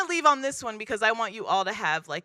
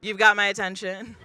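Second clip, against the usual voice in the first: ordinary talk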